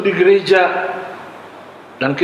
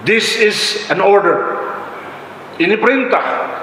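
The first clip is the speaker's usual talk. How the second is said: officer's order; 5 semitones higher than usual. Retold or imitated. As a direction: bark it like an officer giving orders.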